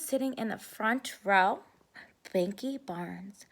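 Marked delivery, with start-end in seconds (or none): 2.3-3.4 ominous hush. A narrator's reading. Ominous reveal of the bully.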